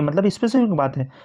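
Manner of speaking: ordinary delivery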